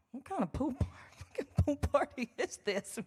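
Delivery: high, disbelieving mock outrage; retold stunned pool party reaction; incredulous disbelief. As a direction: replay the shock of hearing there's valet. Retold shocked reaction at valet.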